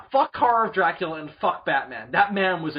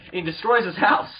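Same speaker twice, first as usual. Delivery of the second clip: ordinary talk, nothing marked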